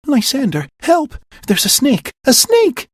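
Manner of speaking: high, loud panicked cries; screaming for help in terror; nightmare scream. Acted. Hermia crying out to Lysander from a nightmare, panicked. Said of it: "calls out in her sleep, in the middle of a nightmare".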